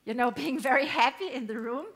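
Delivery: tight, squeezed, fast and loud. Shown as a demonstration. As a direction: squeeze the throat and push it out. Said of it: "if you have a squeezed voice like this".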